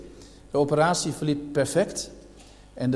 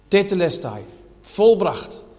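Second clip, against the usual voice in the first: raised, ringing pulpit voice, higher and louder; preaching at full force; 4 semitones higher, 5 dB louder than usual. Acted. Preacher pressing the church with questions, full-force preaching. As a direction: hammer each rhetorical question home to the hall.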